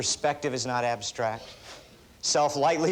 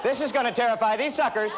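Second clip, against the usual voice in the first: mock bravado; 7 semitones higher than usual. Mocking his naked bravado.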